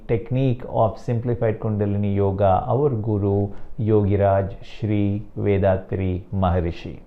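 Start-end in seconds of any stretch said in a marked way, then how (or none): none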